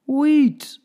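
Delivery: high, loud, joyful cry; captain proudly crying out his prize; cried out. Acted. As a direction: announce the treasure in triumph. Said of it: "cried the captain".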